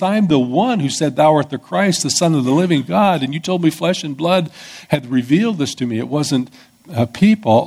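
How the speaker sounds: high, pleading vow of loyalty; earnest Peter. Retold or imitated. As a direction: protest as Peter, heart on sleeve. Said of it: "Peter was speaking the truth that he believed".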